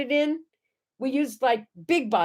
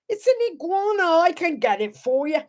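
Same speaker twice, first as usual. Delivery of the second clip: put-on an Australian accent that slides into Cockney; higher Cockney-tinged Australian voice; Australian accent gone Cockney; 7 semitones higher than usual. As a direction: try Australian and land on Cockney. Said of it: "that was more Cockney than Australian".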